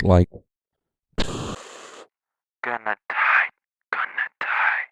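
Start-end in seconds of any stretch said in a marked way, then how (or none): 1.2-4.8 slow whispery radio-filtered "gonna die"; radio effect voice. Shown as a demonstration. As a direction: imitate a bit-crushed radio vocal. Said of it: "a radio effect".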